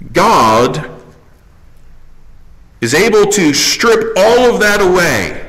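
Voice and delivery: high, loud preacher's cry; full-force pulpit preaching; impassioned preaching. Acted. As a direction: preach it out to the back row.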